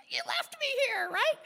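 high, indignant protest. Retold or imitated. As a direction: throw it back at him. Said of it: "I'm like".

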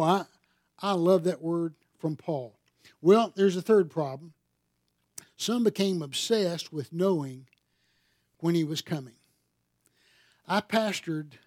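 ordinary talk

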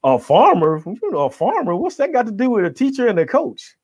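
high, incredulous "a farmer?"; mock disbelief. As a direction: play the doubters scoffing at a farmer. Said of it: "So it's like".

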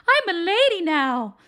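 mock a man putting on a lady voice; high, slow, sneering lady impression; mocking high-pitched voice. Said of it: "talk in a really high-pitched voice like that".

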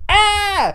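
sharp high yelp of pain; imitated cry of pain; pained yell. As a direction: yelp like you just took a punch. Wrestler yelling when punched.

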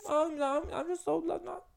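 high, babyish mock whimper; mock little girl. Mocking women pretending to be helpless children. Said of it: "stop pretending you're like".

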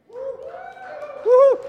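high loud whoop; excited whoop. Real, not acted. Pastor cheering the congregation, excited whoop. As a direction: whoop to fire up the crowd.

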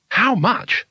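eager and urgent, pitched high. A narrator's reading. Tourist urgently asking the price of the shop's wares. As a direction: blurt it out, desperate to buy. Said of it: "urgently reaching into his pockets".

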